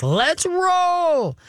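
very high, drawn-out hyped-up cry; nutsy excitement. Acted. Owner imitated hyping up a dog after praise, nutsy excitement. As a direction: whoop it like an overexcited dog. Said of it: "gets them all nutsy in the headsy".

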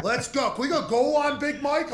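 hammed-up impression of a pushy play call; mocking coach. Punter mocking the coach asking for goal line, high and laughing.

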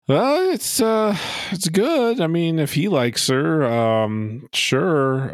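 high hesitant mock mumble, slow at first; imitating mom mumbling. Imitating the mom's reluctant answer, high hesitant mumble. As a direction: hedge it in a high uncertain voice. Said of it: "mom just kind of mumbles something about".

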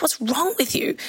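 high, baffled mock question; incredulous trainer. Trainer to her scared student, high mock disbelief. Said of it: "But she was like".

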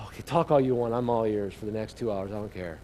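relieved husband, trailing off quietly; relaxed relief. Pretend. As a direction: let the worry drain away.

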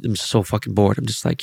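usual speaking voice

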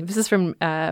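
normal talking voice

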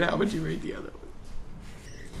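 secretive off-the-record whisper. Pretend. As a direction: breathe it like a secret.

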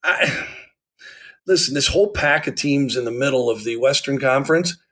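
normal talking voice

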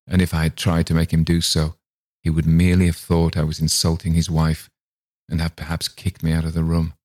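ordinary delivery, nothing marked

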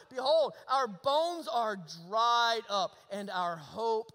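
sermon scripture declamation; impassioned preaching. Real, not acted. Preaches and quotes the lament.

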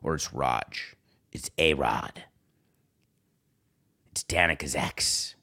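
very low, soft caller voice; hushed and deep, trying out each name; Aaron Rodgers introducing himself. Pretend. Aaron announcing himself to Woody, in a low hush. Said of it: "I wonder if he says it's Aaron".

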